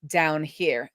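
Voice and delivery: ordinary talk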